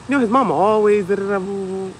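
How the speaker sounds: high, loud mock babble; mocking the mama. Parent mocking the kid's mama with babble. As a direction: blabber like the mama.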